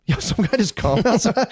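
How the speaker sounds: high, giggling through the words; real laughter breaking up the sentence; cracking up at a voice shouting outside; laughing. Real, not acted.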